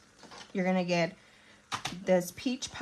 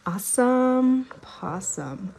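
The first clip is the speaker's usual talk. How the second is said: sing-song slow awesome possum; drawn-out cheerful exclamation; playful catchphrase; 4 semitones higher, 5 dB louder, slower than usual. Acted. Playful catchphrase after camera flip.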